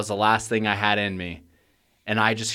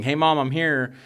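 higher, lightly sing-out greeting; imitated calling out; 6 semitones higher than usual. Grown child outside calling to mom, mock call. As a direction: call it out lightly at a raised pitch.

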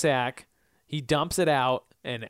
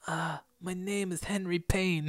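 soft-spoken raspy voice; 7 dB quieter than usual. Retold or imitated. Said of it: "He had kind of a squeaky voice".

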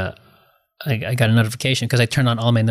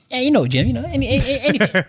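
high, stammering fake nonchalance; badly playing it cool; 12 semitones higher than usual. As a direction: act casual while bursting with nerves. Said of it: "try to play it cool".

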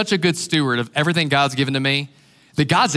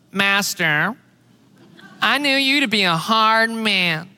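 whiny complainer; 3 semitones higher, slower than usual. Retold or imitated. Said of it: "the complainers have a voice? They got a tone. They're just whiny".

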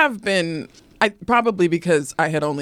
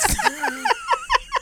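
high giggle. Real, not acted. Laughing at the story.

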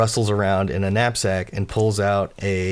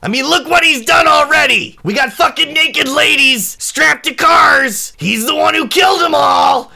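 high, full-volume shouted rant; furious yelling; 10 dB louder than usual. Acted. Gang member outside demands they throw him off, furious shout. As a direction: bellow accusations up at a balcony. Attacker yells accusations up.

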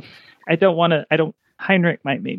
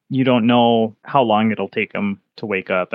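usual speaking voice

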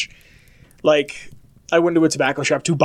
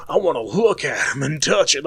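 mock creep. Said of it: "one of these people who are just like".

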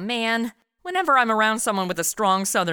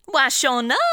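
put-on southern accent; 4 semitones higher than usual. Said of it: "I always start talking like 'em".

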